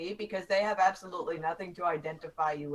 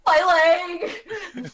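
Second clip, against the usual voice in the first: higher, louder mock cry of pain; Wilhelm scream; 9 semitones higher, 10 dB louder than usual. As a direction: ham the classic movie scream. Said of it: "The Wilhelm scream".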